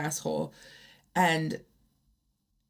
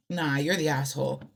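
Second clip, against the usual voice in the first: ordinary delivery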